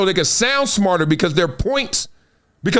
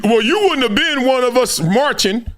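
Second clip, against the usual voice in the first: mocking a critic; 4 semitones higher than usual.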